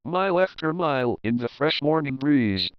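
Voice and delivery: ordinary delivery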